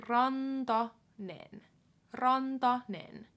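Rantanen said slowly with a tapped r; Rantanen, unhurried; slow syllables. Shown as a demonstration. Teacher modelling name slowly. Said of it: "slow model".

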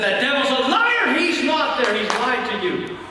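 high, insistent preacher's cry; emphatic rebuke. Acted. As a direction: drive the truth home to a frightened man. Evangelist rebukes the lie.

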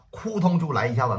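usual speaking voice

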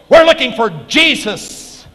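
loud, high, slow arrogant demand. Retold or imitated. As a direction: announce it with swaggering arrogance. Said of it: "they say in an arrogant voice".

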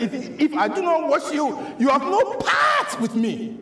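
high, emphatic quoted warning; Jesus answering. Acted. Preacher voicing Jesus warning Peter, high and emphatic. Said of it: "Jesus answered".

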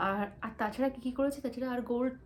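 usual speaking voice

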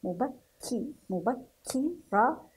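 مبكي then مبكرة in slow syllables, kk held; pressed doubled kaf. Shown as a demonstration. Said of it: "نضغط على الحرف قليلًا". (تهجئة مبكرة مع الضغط على الكاف)

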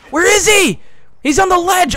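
high, very loud yell; urgent high-pitched gamer callout; frantic shout. Real, not acted. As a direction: yell out the enemy's position in alarm. Frantic callout of enemy sniper.

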